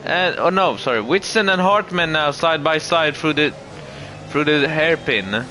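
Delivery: lively play-by-play over the race; motorsport commentator. Acted.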